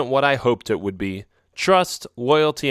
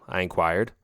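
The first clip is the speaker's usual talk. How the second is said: ordinary delivery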